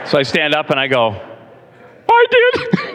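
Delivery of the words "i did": squeaky high with a laugh; laughing admission. Retold or imitated. Pastor owning up to the angry man, laughing high. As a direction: confess it with a nervous laugh.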